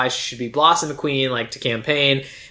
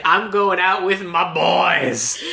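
mock bro boast, drawn out slowly on "my boys"; emphatic MY BOYS; 3 semitones higher, much slower than usual. Co-host imitating a movie guy announcing his night out, emphatic MY BOYS. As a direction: stretch and punch "my boys" in a slow imitation. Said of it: "The emphasis is that is put on the phrase, "my boys," is crazy".

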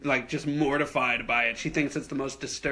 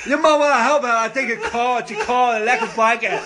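loud, high, slurred imitation of deaf speech; mock deaf voice; 8 semitones higher, 6 dB louder than usual. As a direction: put on a crude fake deaf voice. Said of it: "I did a deaf voice".

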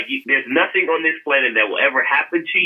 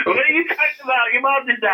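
imitating disbelief; 11 semitones higher, faster than usual. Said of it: "they were like".

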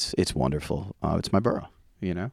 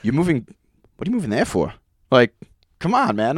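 higher-pitched imitation of a complaining dad; exasperated dad; 6 semitones higher, 4 dB louder than usual. Dad questioning his kid's move to Brooklyn, exasperated. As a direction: imitate dad's mild disbelief. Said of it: "My dad used to say the same thing".

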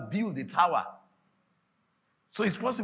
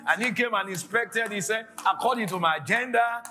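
loud, high prophetic declaration; full-force preaching; 7 semitones higher, 4 dB louder than usual. Real, not acted. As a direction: proclaim it with rising fervour, shouting the refrains.

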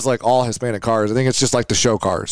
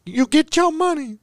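high mock voice; 14 semitones higher than usual. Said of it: "She's like".

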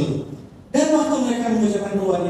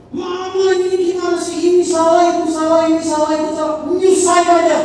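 much higher, much louder scolding; angry shouting; 12 semitones higher, 8 dB louder than usual. Retold or imitated. Daughter-in-law yells at her mother-in-law, furious and shrill. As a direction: yell every "salah" at her.

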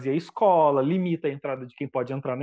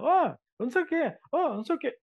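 mock heckler yell; 9 semitones higher, faster than usual. A heckler shouting at the singer, high mock yell. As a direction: pitch up like a heckler shouting from the crowd. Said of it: "o cara gritando".

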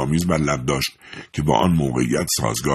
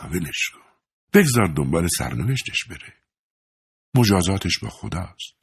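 sighing. A narrator's reading. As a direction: let him go with a heavy heart. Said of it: "آه عمیقی کشید و گفت".

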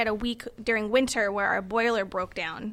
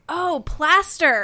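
high, loud, slow "Oh, plaster" in imitation; dawning realization; 5 semitones higher, 6 dB louder, much slower than usual. Handyman finally gets what she means, mock realization. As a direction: stretch it out as if finally understanding.

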